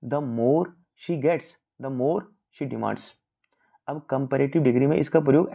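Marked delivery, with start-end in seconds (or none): 0.0-3.0 very slow, word by word example read; slow dictation of an example. Shown as a demonstration. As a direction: read the sentence out slowly, spacing each word. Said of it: "जैसे उसने कहा है".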